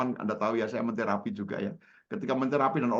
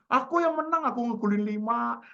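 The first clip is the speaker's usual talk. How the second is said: very high, excited claim of victory; imitated boyish boast; 10 semitones higher than usual. Schoolmate relives winning at football, high excited boast. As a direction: play a child proudly reliving a win.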